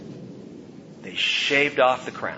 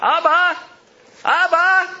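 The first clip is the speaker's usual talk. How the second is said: put-on high, loud child's call across the street; children calling out; 7 dB louder, slower than usual. Child calling to dad across the street, high loud call. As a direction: call out to dad like a kid in Jerusalem.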